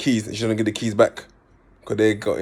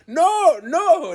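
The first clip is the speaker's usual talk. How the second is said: mock French-accented no, much higher and louder; French accent yell; 3 dB louder than usual. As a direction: imitate panicking French siblings shouting no. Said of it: "they got the French accent".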